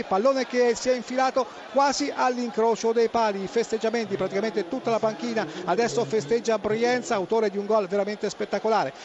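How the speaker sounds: normal talking voice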